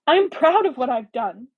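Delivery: impassioned, defiant statement; shaking with emotion. A narrator's reading. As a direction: declare it with wounded pride. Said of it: "her voice shaking with emotion".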